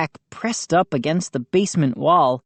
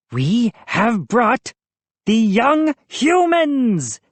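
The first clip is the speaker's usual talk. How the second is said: gleeful announcing gnome; 6 semitones higher than usual. A narrator's reading. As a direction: announce it proudly to the crowd. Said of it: "Hap announced, grinning happily".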